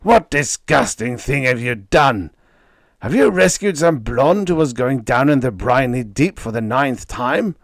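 high, loud indignant screech; screeching fury. A narrator's reading. Manager Leo berating Freddie in the dressing room, screeching. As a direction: tear into him, sneering. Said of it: "he screeched".

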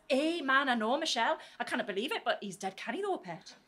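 thick northeastern Geordie banter; hammed-up family voice. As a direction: ham the Geordie accent right up. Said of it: "ham it right up".

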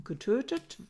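normal talking voice

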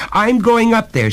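high, loud, frightened resolve; urgent, fearful outburst; trembling cry. A narrator's reading. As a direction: cry it out in alarm. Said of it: "she cried, trembling".

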